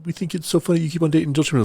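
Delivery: high-pitched mocking imitation; mocking friends. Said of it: "They all made fun of me".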